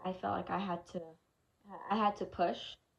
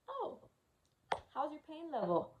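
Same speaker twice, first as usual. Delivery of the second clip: high, sing-song imitation of the nurse; mocking the nurse who finally shows up, high and chirpy; 8 semitones higher, 3 dB quieter than usual. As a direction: mimic the nurse's breezy question, pitched way up. Mimicking the nurse's question.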